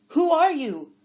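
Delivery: high, loud calling question; yelling. A narrator's reading. Blind man calling after a stranger, loud yell. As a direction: shout the question after her. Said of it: "Adiver yelled".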